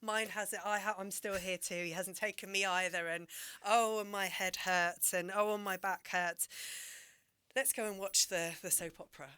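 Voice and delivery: mock-moan her aches to her friend; lightly voiced old woman listing her pains; old neighbour complaining. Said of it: "the other one would say".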